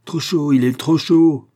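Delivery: cri aigu et fort, lent; s'écrier, furieux du café brûlant; s'écrie. A narrator's reading. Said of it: "s'écria le capitaine". (le capitaine s'écrie contre sa femme, fort et lent)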